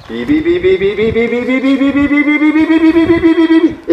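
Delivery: long run of rapid beeps climbing very high; beeping up to peak. Shown as a demonstration. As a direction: mimic the alarm as lift peaks. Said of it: "It peaks".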